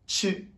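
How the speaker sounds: Chi with a Mandarin ch, high; Chi as the Chinese letters ch; Chinese ch sound. Shown as a demonstration. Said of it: "Et le C H".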